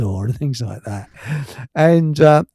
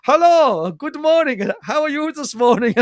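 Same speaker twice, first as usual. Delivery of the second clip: much higher mock French good morning; sitcom French greeting; 11 semitones higher than usual. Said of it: "he looks at us two going".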